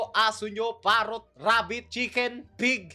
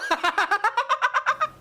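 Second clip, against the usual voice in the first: high, bursting laugh; cracking up at the ad; big laugh; 12 semitones higher than usual. Real, not acted. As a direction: let the laugh explode out. Reacting to meme ad.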